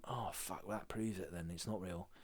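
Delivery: quietly deflated realisation; retold disillusionment. Retold child's private disillusioned realisation.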